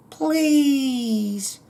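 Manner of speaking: high, long drawn-out whine on the word; hammed-up plea. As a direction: stretch it out high, sliding slowly down. Hammed-up reading of album title.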